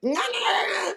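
high, soft sing-song nah-nah; mocking denial. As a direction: tease them with a high little refusal. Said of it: "And they was just".

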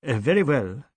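usual speaking voice

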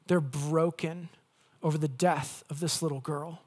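ordinary delivery

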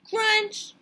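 high, loud burst; sudden loud crunch. A narrator's reading. Reader springs Sammy's bite on his friend, shouted. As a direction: snap the word out as a bite.

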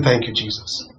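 very soft and low; retold tearful thanks. Pastor remembers his late mentee in grief, hushed. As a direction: imitate her thanks, almost under the breath. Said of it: "She was crying".

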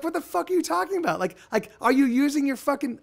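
high, exasperated mock outrage; retelling a reviewer rant, high-pitched incredulous; incredulous outburst. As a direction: act out disbelief at a dumb review. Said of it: "I'm like".

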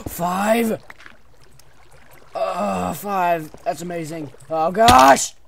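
loud, high thrilled cheer; excited. Real, not acted. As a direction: shout with joy at the haul. Counting diamonds, thrilled.

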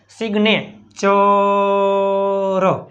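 slow model reading. Shown as a demonstration. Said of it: "slow model reading".

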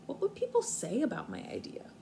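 normal talking voice

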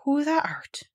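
barely whispered, awestruck; old man stunned, whispering; shaky whisper. Acted. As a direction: breathe it out in shock. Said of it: "in a queer, shaky voice".

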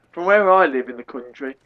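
ordinary delivery

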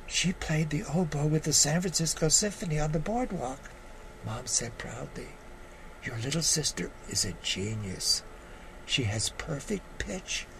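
measured storytelling read with voiced quotes; memoir reading. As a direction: read your story to the audience. Reads his memoir piece aloud.

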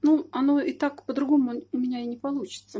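ordinary talk

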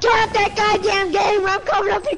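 high, rapid-building mock threat; imitated angry mom. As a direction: mimic a mother yelling upstairs. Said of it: "And she's like".

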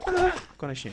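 finally, at last, let it burst out; thrilled the loot finally turned up; relieved excitement. Real, not acted. Finally finds the potion he wanted.

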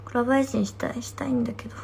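secret whisper of コラボ配信したい. Shown as a demonstration. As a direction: breathe it as a secret wish. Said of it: "コラボ配信したら。". (ささやき声で「コラボ配信したい」)

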